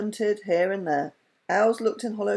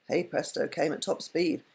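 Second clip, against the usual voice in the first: ordinary delivery, nothing marked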